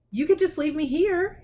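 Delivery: a hint of a hopeful Harry. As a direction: slip it in hopefully. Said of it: "Harry put in hopefully".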